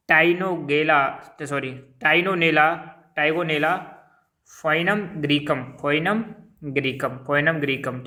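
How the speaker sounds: "Tigonella foinum greecum" repeated slowly and clearly; slow repeated dictation. Shown as a demonstration. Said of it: "दोबारा मैं बोल रहा हूँ इसको".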